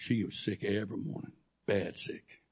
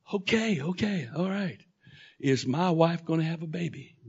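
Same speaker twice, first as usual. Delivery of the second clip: excited giving in; 6 semitones higher, 5 dB louder than usual. Retold or imitated. Giving in, asking God.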